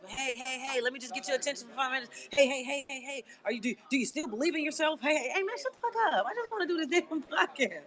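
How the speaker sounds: high, nagging mock voice of people begging attention; mocking pesterers. Mocking the people who message her, high and nagging.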